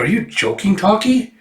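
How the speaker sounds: high, rising disbelief; incredulous question. Acted. Lucy asks Talkie if she's joking, incredulous. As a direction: pitch shoots up in disbelief. Incredulous at not knowing internet.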